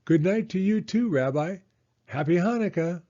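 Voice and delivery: Baba Brena. Acted. Baba Brena calling goodbye.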